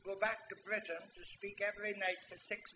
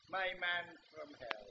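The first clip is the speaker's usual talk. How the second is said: ordinary talk, nothing marked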